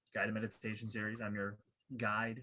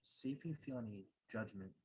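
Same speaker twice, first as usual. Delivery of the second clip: calm, measured meditation guide, softer than a welcome; guided meditation; 6 dB quieter than usual. Acted. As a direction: guide listeners gently inward, leaving long pauses.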